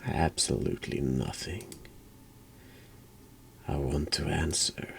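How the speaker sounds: ordinary talk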